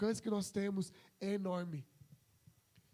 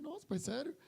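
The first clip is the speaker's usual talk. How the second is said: higher, lightly surprised question; mock surprise; 6 semitones higher, 4 dB quieter than usual. Son answering his father's praise, mildly surprised. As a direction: feign surprise at dad. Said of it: "Eu falei".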